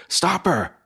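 high, urgent cry of alarm; alarmed shout. Acted. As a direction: call out sharply to halt someone. Fox raises the alarm.

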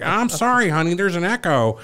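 high-pitched imitation of a woman; imitated lady seller. Lady confused by prank caller, high imitated voice. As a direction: raise the voice into a lady on the phone. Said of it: "She's like".

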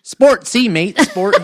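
high, loud mock-Australian accent. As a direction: call it out in hammed-up Australian. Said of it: "Do you wanna try an accent".

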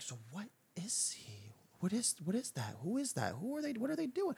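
hushed, rising gossip whisper; curious whisper. Retold or imitated. The crowd whispering about the stranger in a hushed voice. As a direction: whisper it like nosy onlookers.

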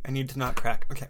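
ordinary delivery

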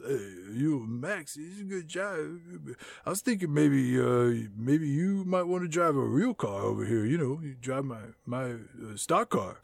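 low, soft lazy creep; low and quiet like a sleazy guy; Matt Dillon voice. Retold or imitated. Host voicing Matt Dillon luring Lindsay, low soft creep.

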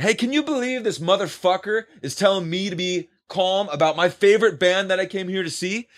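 high, projected, indignant complaint; real loud. Retold or imitated. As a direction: announce your outrage so everyone nearby hears. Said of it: "I said real loud".

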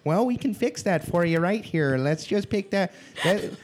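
high, bright Minnesota receptionist impression; Midwestern receptionist. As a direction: mimic a chirpy Minnesota accent on the phone. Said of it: "these people sound horrible".

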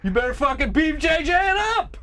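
high, fast, hammed-up French Canadian accent; bad GSP Canadian accent. Egging on Ontario grapplers, hammed-up GSP French Canadian accent. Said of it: "With the worst Canadian accent ever".

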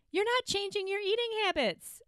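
high, amused delivery; stating the obvious with a laugh; amused, near laughing. Real, not acted. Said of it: "I'm laughing because it's just a basic".

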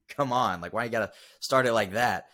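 loud pleading complaint; exasperated come on. Retold or imitated. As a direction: playfully cry out why start it like that. Exasperated retort to mom's message.

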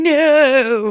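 loud, high cry of despair; anguished shout. Retold or imitated. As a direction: yell it from inside your head, helpless. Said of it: "Jonesy's in his mind room going".

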